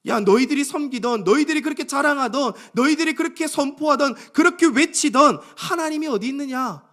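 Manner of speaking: high, insistent jeer in a rising chain of challenges; mocking taunt. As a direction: taunt them like a conquering enemy. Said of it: "뭐라고 조롱합니까?". (높은 목소리로 몰아붙이는 조롱)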